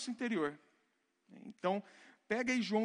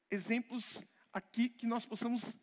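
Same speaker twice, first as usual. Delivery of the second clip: normal talking voice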